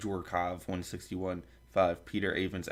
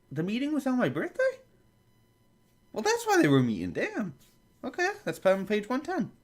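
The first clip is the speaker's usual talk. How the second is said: very high, loud squeal; mock astonishment; 7 dB louder than usual. Reader finds the meeting was on their birthday, astonished squeal. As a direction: squeak it out in exaggerated shock.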